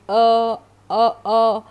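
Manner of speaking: short, loud "a, a, a", each separate; isolated vowel sound. Shown as a demonstration. Teacher showing what sound X gives, loud separate "a" sounds. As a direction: punch out the bare vowel "a" as in elephant's start. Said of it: "sound of E".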